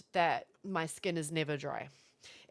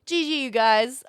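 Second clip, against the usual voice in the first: slow, loud, sugary "G G"; mock-sweet sign-off to an angry lobby, slow and loud; 4 semitones higher, 10 dB louder than usual. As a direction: kill them with kindness. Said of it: "I'll just be like".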